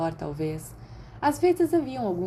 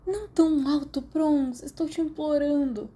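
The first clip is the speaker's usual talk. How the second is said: pained, hungover plea, pitched higher; groaning plea; 8 semitones higher than usual. A narrator's reading. Hungover Peter begging James to stop shouting, groaning. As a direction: beg him to keep it down, head aching.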